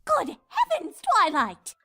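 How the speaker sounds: loud startled gasp; shocked lady. Acted. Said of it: "gasped Rarity".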